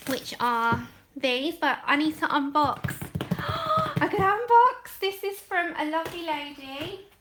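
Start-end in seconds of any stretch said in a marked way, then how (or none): 3.0-4.0 sharp high gasp of delight. Real, not acted. Gasping at the gift she is about to unbox. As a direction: gasp at the sight of the box.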